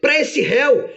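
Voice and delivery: high-pitched, indignant protest; flustered, acted outrage; Satanás, bewildered. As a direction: object in disbelief to the defence lawyer. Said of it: "E Satanás, atordoado, diz".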